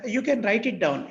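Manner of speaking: normal talking voice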